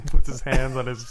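normal talking voice

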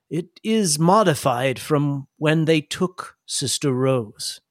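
slow, measured character voice; Microbial. Acted. Microbial explains the new spore variant, slow and measured. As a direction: explain slowly and deliberately, in character. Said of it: "and he says".

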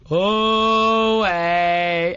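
loud, drawn-out oh hey called back; group holler. Retold or imitated. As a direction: holler back as the whole crowd of parents. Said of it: "in unison holler back".

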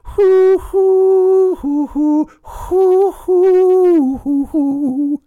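high hooting mock lute tune; imitated lute. Shown as a demonstration. Comic plays an imaginary lute for the audience, loud high hooting. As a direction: hoot out a silly lute melody, loud and high. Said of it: "air fingering it right now".

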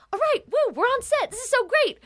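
high, hyped-up fake cheer; forced enthusiasm. Actor psyching themself up on arriving at set, forced enthusiasm. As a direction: put on peppy on-set excitement way up high. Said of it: "try to be like".

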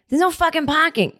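high-pitched Boston gripe; mock Boston-accented delivery guy. Annoyed furniture guy complaining about parking, Boston accent. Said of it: "I'm giving him a Boston".

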